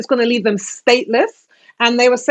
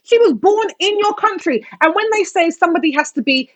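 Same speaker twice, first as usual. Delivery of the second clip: much higher-pitched, heated insistence; impassioned; 8 semitones higher than usual. Real, not acted. Arguing to friends against stripping her citizenship, impassioned. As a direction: argue the point passionately, voice raised in pitch. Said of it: "I get passionate about the Shamima situation".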